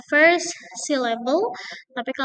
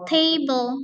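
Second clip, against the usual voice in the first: Table, TA-ble, first syllable punched; stress on TA. Shown as a demonstration. Teacher models noun stress on Table. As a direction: lean on TA of Table. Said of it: "stressing on the first syllable".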